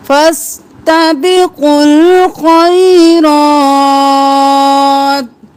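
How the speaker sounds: slow melodic tajweed chant; Quran recitation. Shown as a demonstration. Recites the verse line. (قرآن کی تلاوت، آہستہ اور سریلی)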